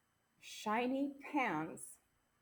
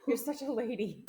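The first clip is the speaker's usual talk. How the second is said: laughing; 3 semitones higher, 7 dB louder, slower than usual. Pretend. Teasing friend after burp.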